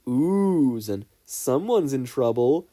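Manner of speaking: put-on a classroom ribbing a kid who's been called in; teasing classmates. Classmates oohing at Midoriya, slow sing-song taunt.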